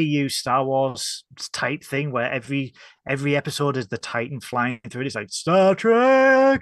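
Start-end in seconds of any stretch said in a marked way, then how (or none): 5.3-6.6 high, loud title shout; announcer shout. Retold or imitated. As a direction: belt it like a trailer. Imitating show opening.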